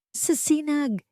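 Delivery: high, shaky whisper of amazement; a voice quivering with disbelief; trembling voice. Acted. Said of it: "nanginginig niyang sabi". (nanginginig na boses sa pagkamangha)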